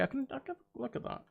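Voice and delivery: ordinary talk, nothing marked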